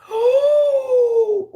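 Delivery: loud high gasp of recognition; sharp gasp. Real, not acted. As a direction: gasp as it clicks. Realising which episode.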